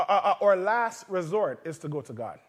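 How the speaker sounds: ordinary delivery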